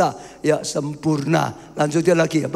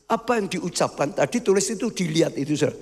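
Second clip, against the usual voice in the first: ordinary delivery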